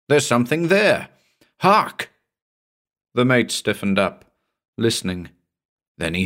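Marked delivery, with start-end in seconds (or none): 0.1-2.1 high, urgent alert building to a sharp 'Hark'; alarmed warning. Acted. Narrator warning mate of thing at rail, alarmed warning. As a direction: make him stop and listen.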